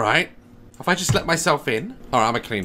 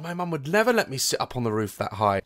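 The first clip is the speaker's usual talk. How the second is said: usual speaking voice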